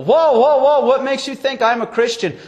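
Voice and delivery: high, loud burst of startled protest; alarmed denial. Acted. Preacher replays his reply to an inmate's Bible question, alarmed denial. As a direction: blurt it out as if caught out.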